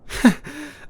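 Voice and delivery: high, caught-out giggle; sheepish laugh. Real, not acted. Boyfriend caught hinting at tomorrow, sheepish giggle. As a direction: laugh like you've been caught.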